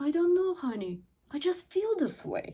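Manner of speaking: high, soft and helpless; high girlish softness; soft feminine voice. Shown as a demonstration. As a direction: sound gently lost, like a sweet wife. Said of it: "I switch to my feminine energy".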